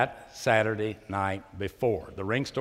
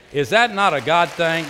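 louder repeated question; 7 semitones higher, 11 dB louder than usual. Real, not acted. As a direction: raise it higher and louder the second time. Preacher repeats rhetorical question louder.

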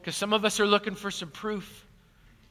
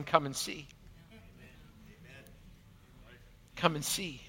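gentle invitation; 6 semitones lower, 15 dB quieter than usual. Pretend. King of Kings inviting gently.

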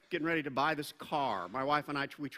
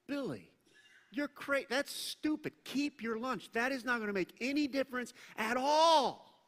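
high, mocking skeptic's voice, pitched well up; scoffing crowd member; 7 semitones higher than usual. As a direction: scoff at the boy like a know-it-all in the crowd. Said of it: "someone in the crowd going".

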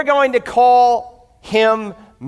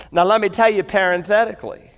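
ordinary talk

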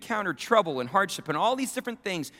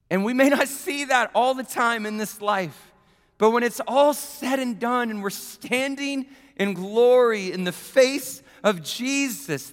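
louder, higher, building sermon climax; preaching at full force; 8 semitones higher, 5 dB louder than usual. Acted. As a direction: preach it like a blowout victory.

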